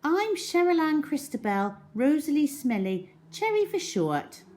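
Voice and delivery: put-on high, proud girl reciting her names; chirpy girl showing off her long name; little girl voice. A narrator's reading. Cherry introduces herself.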